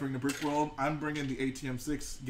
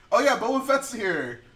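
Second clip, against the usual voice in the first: mock offhand reaction; 7 semitones higher, 7 dB louder than usual. Mimicking an offhand reaction, contrasted.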